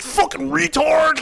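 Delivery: high, slow mocking shout of an insult; mock yell. Recalling schoolyard talk, a mock shouted insult. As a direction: bellow the insult like a kid in the schoolyard.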